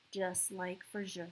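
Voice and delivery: ordinary delivery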